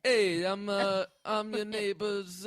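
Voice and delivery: high, drawn-out Boston-style voice; hesitant intro in a mock Boston accent; put-on Boston accent. Posing as the neighbor's brother, put-on Boston accent. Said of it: "Now we're in Boston".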